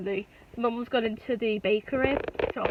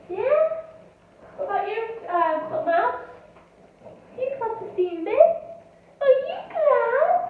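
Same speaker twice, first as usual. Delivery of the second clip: talking to a pet; 10 semitones higher than usual. Real, not acted. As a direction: coo gently at a little pet. Cooing at the cat.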